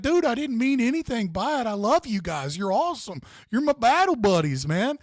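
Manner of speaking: hammed-up sincere apology to his battle buddies; pleading Alaska guy. Alaska guy making peace with soldiers.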